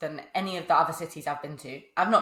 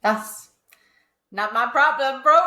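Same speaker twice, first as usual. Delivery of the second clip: loud, higher mock brush-off; joking dismissal; 6 semitones higher, 7 dB louder, slower than usual. Co-host jokingly waving away others' lack of choice, mock dismissive. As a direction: brush it off loudly, for laughs.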